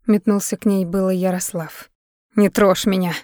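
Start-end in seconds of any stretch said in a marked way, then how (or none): none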